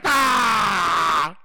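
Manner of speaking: loud hammed-up groan; mock eager moan. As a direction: let out one overeager moan. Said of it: "He's like".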